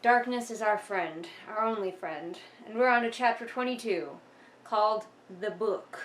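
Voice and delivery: usual speaking voice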